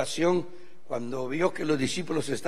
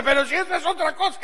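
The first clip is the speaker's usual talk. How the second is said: wife laughing; 12 semitones higher, 5 dB louder, faster than usual. Retold or imitated. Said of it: "me dice".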